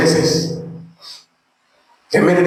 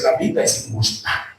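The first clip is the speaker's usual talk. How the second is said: mimicked complaint; 11 semitones lower, 6 dB quieter than usual. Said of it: "cuando tú dices".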